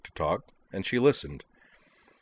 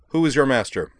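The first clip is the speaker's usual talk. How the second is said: harsh, raised, louder questioning; harsh demand; 6 semitones higher, 6 dB louder than usual. A narrator's reading. Professor interrogates the robot student, harshly. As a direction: demand it with no human warmth. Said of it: "asked Harris harshly".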